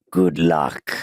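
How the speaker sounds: low, drawn-out waiter as villain; good luck voice. Retold or imitated. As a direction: answer like a movie villain, low and slow. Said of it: "That's the good luck voice".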